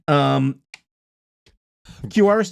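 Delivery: ordinary delivery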